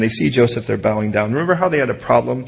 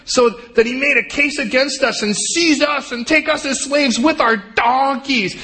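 high, panicky voice of the frightened brothers; high fearful pleading; panicked brothers; 14 semitones higher than usual. Pretend. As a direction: squeak it out like scared men.

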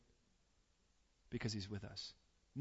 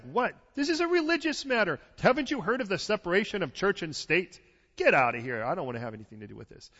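exasperated dismissal; 3 semitones higher, 7 dB louder than usual. Retold or imitated. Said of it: "The guy's like".

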